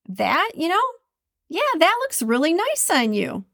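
high, sugary backhanded compliment; fake sweet. Shown as a demonstration. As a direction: sweeten it until it stings. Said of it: "Totally two different meanings".

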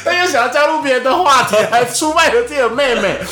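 high, loud laughing explanation; cracking up at herself. Real, not acted. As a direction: laugh while defending yourself. Laughing at own story.

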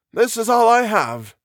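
put-on high, slow old professor voice; high, final character reply; brief statement. A narrator's reading. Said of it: "the other said briefly".

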